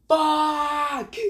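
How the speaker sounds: long high-pitched yelled curse; retold scream. Dragon cursing his lost bet, high mock scream. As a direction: scream it out high, drawing the word out. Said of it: "screams so loud that even the Raikage can hear him".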